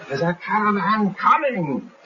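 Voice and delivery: high, slow shrieking announcement; scream. Retold or imitated. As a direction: scream it out to the gang. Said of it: "And he screams".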